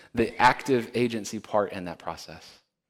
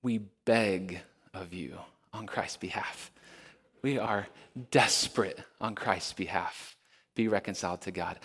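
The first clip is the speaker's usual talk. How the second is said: desperate begging; 5 semitones lower, 7 dB quieter than usual. Retold or imitated. Preacher voices Paul's desperate plea, low and soft. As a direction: beg from the heart, quietly. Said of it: "the language is much more like this".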